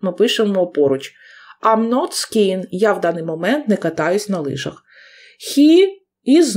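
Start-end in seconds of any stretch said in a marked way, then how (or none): 1.6-2.6 slow, clear "I'm not skiing" with the contraction; slow contracted form. Shown as a demonstration. Teacher models the contraction for the class, slow and clear. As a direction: read the short form out slowly. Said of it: "як у нас am not скорочується".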